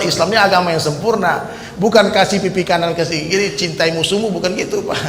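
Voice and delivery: ordinary talk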